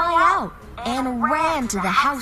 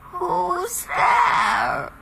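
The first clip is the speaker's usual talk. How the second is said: put-on old woman voice; 9 semitones higher than usual. A narrator's reading. Grandmother calls from inside.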